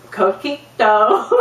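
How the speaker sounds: "Coquito" slow, rising, in an American accent. Shown as a demonstration. Speaker mocking her own attempt, "Coquito" in a slow American accent. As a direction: stretch "Coquito" with American English vowels.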